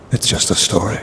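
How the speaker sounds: normal talking voice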